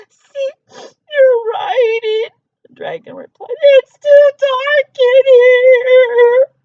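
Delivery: very high, loud, slow wail; crying dragon. Acted. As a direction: blubber through the words. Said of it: "The dragon began to cry".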